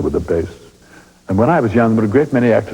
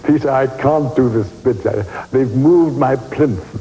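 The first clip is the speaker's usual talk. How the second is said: mild mock shout; 6 semitones higher, slower than usual. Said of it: "she shouted out".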